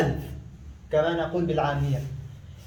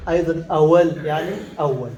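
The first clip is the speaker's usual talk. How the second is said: usual speaking voice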